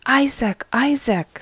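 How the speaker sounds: excited friend calling the sick man. Acted. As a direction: call him, bursting with news. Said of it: "You are excited".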